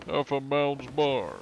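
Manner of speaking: high, slow cartoon-voice attempt; Joe Swanson. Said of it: "in a Joe Swanson voice".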